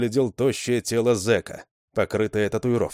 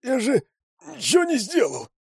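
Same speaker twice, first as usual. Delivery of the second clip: much higher, desperate cry; pained shout. A narrator's reading. Winded Rzhavy protests on the road, high and desperate. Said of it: "простонал он".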